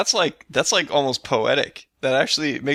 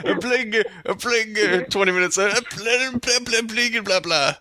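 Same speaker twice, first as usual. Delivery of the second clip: higher, stuck repeating playing; mocking stammer; 8 semitones higher than usual. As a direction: mimic a stuck stammer, pitched up. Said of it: "You're really stuck in that word".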